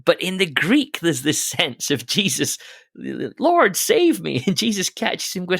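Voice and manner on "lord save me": high, slow, pleading cry; desperate cry for help. Retold or imitated. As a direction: plead like a man sinking. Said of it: "he cries out".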